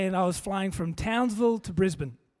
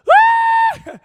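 loud, high mock wail; hammed-up bawling scream; ugly crying; 15 dB louder than usual. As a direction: wail like a heavy metal screamer. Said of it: "She is ugly crying".

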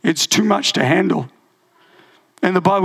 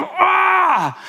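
drawn-out, high "aah" of frustration; frustrated yell; 11 semitones higher than usual. Acted. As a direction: let out a high yell of exasperation. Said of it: "you've just yelled in frustration".

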